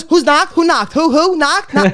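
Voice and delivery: high, flustered mother impression; imitating confused mom. Mom misunderstanding a knock-knock joke, imitated confusion. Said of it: "She goes".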